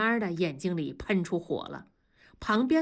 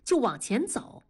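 usual speaking voice

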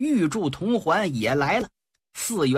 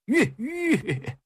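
horse-halting call; 6 semitones higher than usual. Acted. Reining in the horse.

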